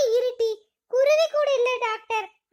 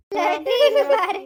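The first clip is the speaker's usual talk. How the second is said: loud, slow scolding call; slow it down and bark the name; sharp scold; 6 dB louder, slower than usual. Acted. Scolding the little girl Lattu.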